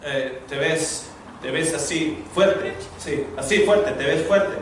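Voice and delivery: soft, slow, hesitant compliment; mock-shy wife trying to flirt; awkward flirting. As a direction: fumble a compliment, half-shy.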